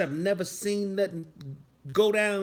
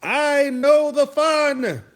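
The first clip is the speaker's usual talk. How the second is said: put-on high, loud, drawn-out whine of a kid; hammed-up youngster voice; mocking young kids; 7 semitones higher, 7 dB louder, slower than usual. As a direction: mimic a boastful kid, slow and high. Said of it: "these young kids who says".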